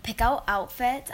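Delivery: ordinary talk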